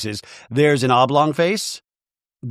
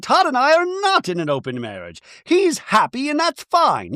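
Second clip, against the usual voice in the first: higher, prim insistent Bronwyn voice; high Bronwyn impression; 10 semitones higher than usual. Mocking Bronwyn insisting her husband is happy, pitched up. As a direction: play Bronwyn, pitched up and defensive. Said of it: "And Bronwyn's like".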